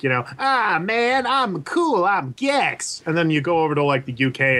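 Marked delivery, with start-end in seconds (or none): 0.4-3.0 high, brash cartoon dude voice; cool cartoon lizard. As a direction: ham up a cocky mascot. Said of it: "the American one is super, like".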